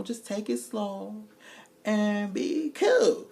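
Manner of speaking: slow, high, laid-back; easy drawl. Retold or imitated. Harley slowly telling Marcus to take it slow. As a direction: stretch the words out.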